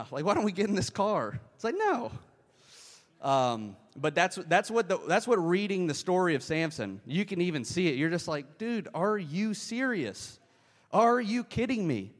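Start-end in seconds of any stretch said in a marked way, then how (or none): 0.2-1.4 higher, airy clueless voice; lightly voiced clueless teen; mock naive horror victim. Mocking horror movie characters. 1.8-2.1 a very high, squeaked no; high squeal of a no; mock squeaky no. Mocking horror movie viewer. 8.6-12.1 higher, slow, drawn-out disbelief; higher, louder mock outrage at a fool; exasperated disbelief. Exasperated viewer of Samson story.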